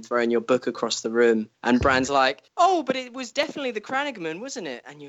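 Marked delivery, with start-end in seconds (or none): 2.6-4.8 high, sing-song naive mock voice; mocking Bran. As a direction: play dumb in a high innocent voice. Mocking Bran's naive question.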